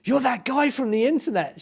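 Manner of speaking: imitating an excited fan spotting a celebrity. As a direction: gush like a star-struck fan. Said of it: "so excited".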